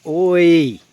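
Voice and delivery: loud barked oi at the dog; real loud telling-off; sharp scolding shout. Real, not acted.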